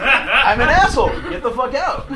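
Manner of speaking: loud, high mock-incredulous outburst; retold outraged shout. Driver kicking his date out, mock furious shout. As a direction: re-enact throwing someone out, loudly.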